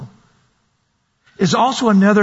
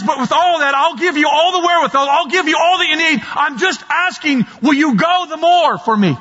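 voicing God, pitched higher, rising to shouted pleas; God begging his people to give more; preacher speaking as God, raised and urgent; God pleading; 7 semitones higher than usual. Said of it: "But He says".

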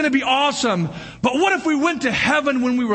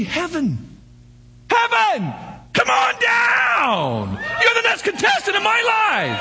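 game show announcer; 12 semitones higher, slower than usual. Pretend. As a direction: summon heaven like a game show host. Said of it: "Do we say".

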